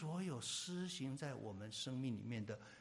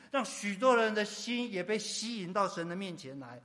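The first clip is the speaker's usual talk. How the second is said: raised, driving sermon delivery; emphatic pulpit voice; impassioned preaching; 6 semitones higher, 12 dB louder than usual. Real, not acted. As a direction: build phrase on phrase with forceful conviction. Preaching at full force.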